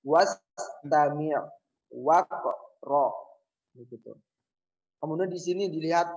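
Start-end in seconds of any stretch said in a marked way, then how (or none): 0.1-3.1 slow model reading of "Wastami', waqra", each wa linked straight on; joined hamzat wasl. Shown as a demonstration. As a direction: say "Wastami', waqra" slowly, hamza silent in both. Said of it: "bacanya gimana?".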